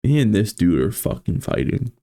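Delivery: ordinary delivery, nothing marked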